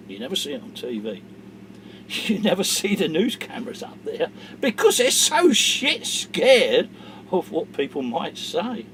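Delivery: usual speaking voice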